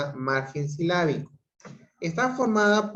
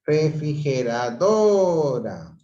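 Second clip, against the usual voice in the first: "Refrigeradora" slow, with "do" stretched, raised and loud; stress on DO; 4 dB louder, slower than usual. Shown as a demonstration. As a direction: swell hard on the "do" of "Refrigeradora". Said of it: "la mayor fuerza de voz". ("Refrigeradora" lenta, alargando "do" con mayor fuerza de voz)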